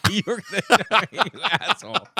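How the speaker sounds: laughing through the words; laughing at co-host's botched intro; laughing insult. Real, not acted. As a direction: crack up while scolding. Laughing at his partner.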